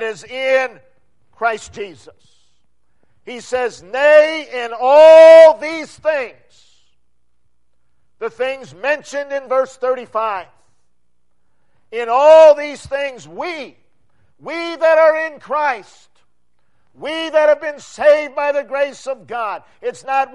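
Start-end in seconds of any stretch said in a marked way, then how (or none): 3.8-6.8 slow, loud triumphant proclamation; shout of triumph. Acted. Preacher to congregation, quoting Paul: slow triumphant shout. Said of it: "is a shout of triumph". 11.9-19.6 triumphant shout. Acted. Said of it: "is a shout of triumph".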